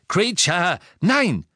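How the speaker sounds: hoch und laut dazwischenrufen; scharfer Ruf. A narrator's reading. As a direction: den Angreifer mit einem Ausruf stoppen. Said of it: "rief Harry". (energisch gerufener Befehl)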